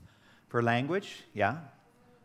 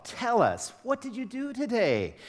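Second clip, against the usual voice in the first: insistent parent; 11 semitones higher than usual. Retold or imitated. A father demanding his son tell about his day, in a high insistent voice. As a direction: insist on an answer, pitched way up.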